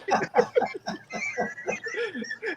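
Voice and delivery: high-pitched giggling laugh; real squealing laugh. Real, not acted. Laughing at Steve.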